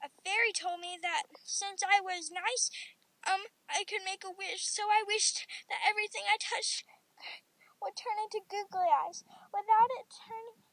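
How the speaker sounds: mildly distressed storybook cry; cried out. A narrator's reading. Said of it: "I cried".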